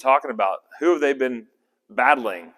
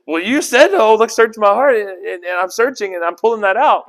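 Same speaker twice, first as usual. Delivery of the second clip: indignant protest; 9 semitones higher, 8 dB louder than usual. Acted. Worshipper arguing back with God.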